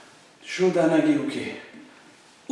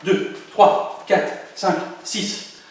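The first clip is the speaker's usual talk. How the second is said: rapid-fire count; very fast counting; 3 semitones higher, much slower than usual. Shown as a demonstration. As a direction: rush the numbers. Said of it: "Encore plus vite". (comptage très rapide)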